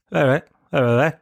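mumbled Tralee "all right"; curt Tralee-style hello; Tralee nod greeting. Retold or imitated. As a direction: toss off a quick Tralee greeting. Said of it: "Bit of a Tralee nod".

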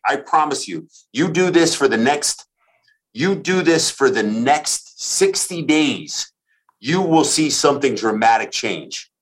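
ordinary talk, nothing marked

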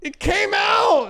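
high, slow shouted squeal; imitating girlfriend's shriek; mock disgust. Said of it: "I'll hear her be like".

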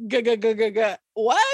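stammering on the g before blurting what; tripping over his words, caught off guard; flustered stammer. Retold or imitated. Pacey stunned by a hookup offer, stammering.

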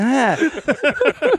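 high startled yell, imitated; mock scream. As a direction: shriek as if caught off guard. Narrator screams again, caught.

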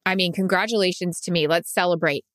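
upbeat sponsor read for the listeners. Acted. Two podcast hosts reading a sponsor spot, upbeat ad voice. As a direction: sell it like a cheerful host.

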